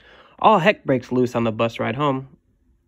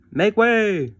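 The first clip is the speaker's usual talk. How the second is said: calling out; 8 semitones higher, 4 dB louder than usual. Acted. Kid running downstairs shouting.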